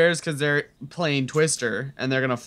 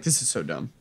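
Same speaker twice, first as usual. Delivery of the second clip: weary dismissal; 4 semitones lower, 6 dB quieter than usual. Retold or imitated. Quoting own weary reaction.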